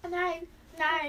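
high, drawn-out refusal; loud protest. Real, not acted. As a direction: plead loudly against it. Refuses more mask on face. (laut und gedehnt protestieren)

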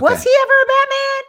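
very high, loud disbelief; surprised. Real, not acted. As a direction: shriek it in astonishment. Incredulous question.

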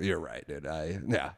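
low, soft, chastened mumble; sheepish. Retold or imitated. Storyteller admitting fault to the man, sheepish. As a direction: concede it quietly, deflated.

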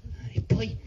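high eager question; eager father. Acted. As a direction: lean in, curious dad.

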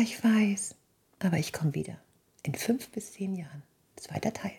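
whispered consoling reply; soft whisper. Retold or imitated. Conny quietly reassures the couple, whispered. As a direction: breathe it softly like a secret.

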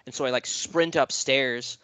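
ordinary talk

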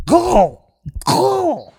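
high shrill gobble call; turkey impression. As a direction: squawk it like a turkey. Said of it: "My annual turkey impression".

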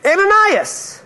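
high, drawn-out call of a name. Acted. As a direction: call to him from above. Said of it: "the Lord called to him".

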